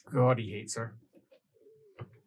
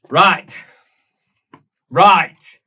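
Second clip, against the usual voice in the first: louder, emphatic declaration; loud decisive call; 5 semitones higher, 15 dB louder than usual. Acted. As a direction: bark it to settle things. GM rallying loudly.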